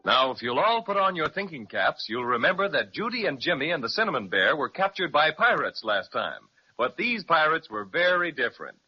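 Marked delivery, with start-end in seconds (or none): none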